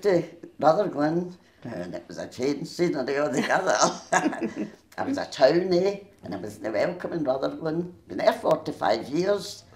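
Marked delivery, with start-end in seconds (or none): 4.1-6.0 laughing through the words. Real, not acted. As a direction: chuckle as you say it. Laughing while telling move.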